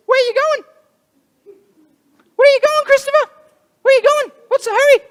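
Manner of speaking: very high, squeaky, loud bunny; chirpy cartoon bunny pestering him; bunny voices. Bunnies hopping beside Christopher asking him, squeaky and high. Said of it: "They said".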